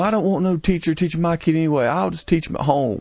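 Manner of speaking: mock caller. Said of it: "I was basically saying".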